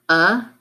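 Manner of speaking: a as the short "uh" in bug; isolated vowel sound. Shown as a demonstration. Teacher naming the vowel to swap, a said as short "uh". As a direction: say a alone as "uh". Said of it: "change the vowel sound".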